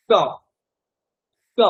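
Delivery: স said with the tongue curled back, a hard sh; retroflex s. Shown as a demonstration. Teacher demonstrating the retroflex s to the class. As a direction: hiss স with the tongue tip turned up. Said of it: "মূর্ধন্য স উচ্চারণ করেন কি হবে?".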